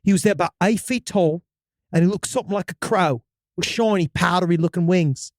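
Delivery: storyteller in a put-on British accent; hammy English-accented first-person tale. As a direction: read it with an amateur British accent. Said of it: "Can you stick with this accent".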